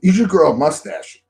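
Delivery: high, blurted-out idea; imitated perky suggestion. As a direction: suggest it out of nowhere, keenly. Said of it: "And then she goes".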